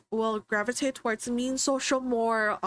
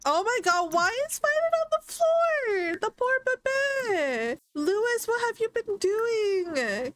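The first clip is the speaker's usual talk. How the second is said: shocked dismay; 10 semitones higher than usual. Real, not acted. Streamer finding the neglected Sim baby on the floor, shocked and scolding. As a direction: gasp it out, alarmed and scolding.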